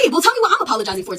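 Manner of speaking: insist, voice up in frustration; heated demand to know why; exasperated demand. Retold or imitated. Demanding explanation.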